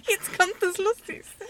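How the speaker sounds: high and slow, laughing through it. Real, not acted. Building up to the funniest part, laughing high. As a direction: giggle while announcing the best part.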